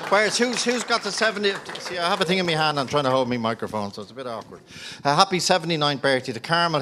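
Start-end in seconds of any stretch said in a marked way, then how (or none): none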